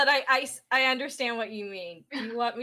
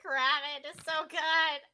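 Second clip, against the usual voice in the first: gushing; 6 semitones higher than usual. Real, not acted. Admiring outfit.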